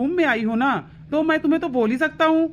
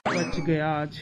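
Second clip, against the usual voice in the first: low, soft aside to himself; relieved aside; 11 semitones lower, 6 dB quieter, much slower than usual. Acted. Husband alone after wife leaves, relieved low aside. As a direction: say it under your breath, much lower.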